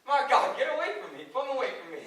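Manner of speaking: high, loud, laughing exclamations; overwhelmed and teasing; flustered. Acted. Curly overwhelmed by Laurie.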